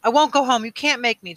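high, loud, distraught refusal; sobbing shout. A narrator's reading. Boy refusing to go home, sobbing shout. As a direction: shout it through sobs, pitched high. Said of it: "Jonas sobbed and shouted".

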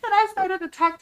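loud laughing high voice. Real, not acted. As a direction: laugh through the words. Laughs while telling funny story.

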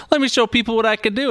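high, bright eager boast; eager quarterback. Retold or imitated. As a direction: show off, high and keen. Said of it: "Ryan Fitzpatrick's like".

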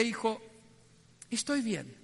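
father reassuring his tearful son, soft and low; tender father. Retold or imitated. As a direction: answer your son gently, almost under breath. Dying father answers son tenderly.

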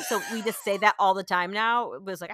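ordinary delivery

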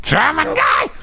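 shrill squeaky shout of a game character; high-pitched yell. Retold or imitated. Voice actor showing game screams, high-pitched yell. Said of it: "screaming high-pitched voices".

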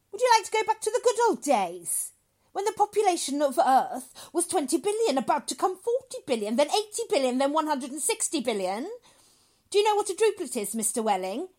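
loud, high, hectoring lecture; severe. Acted. Doctor lectures father on population, loudly severe. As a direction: hammer each figure at him. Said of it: "became rather severe with Welling".

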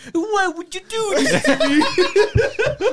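high, slow, hammed-up Italian accent with a laugh; mock Italian movie-villain plea in an Italian accent; exaggerated Italian accent, half laughing; Jared Leto's Italian accent. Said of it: "what is this accent?".

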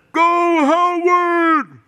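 long drawn-out high holler; dad cheering from crowd. Retold or imitated. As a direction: bellow it out to cheer on an athlete. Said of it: "if I heard my dad's voice".

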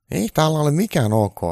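high, loud irritable voice; grumpy Trump. Acted. Trump grumbling at Santa, high and cranky. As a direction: snap back crankily. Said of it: "Donald Trump sanoi äkäisesti".

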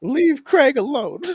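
high, slow, wailing defence; exaggerated drawn-out protest; mock desperate plea. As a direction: plead for him in a hammed-up high voice. Mock plea defending the bot Craig.

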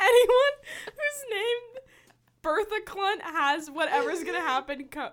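high cracking laugh through words; laugh-crying through the line; laughing hard. Real, not acted.

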